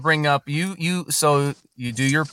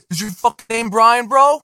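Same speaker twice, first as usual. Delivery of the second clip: mock tough-guy question; 8 semitones higher, 3 dB louder than usual. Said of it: "it sounds like the way you asked".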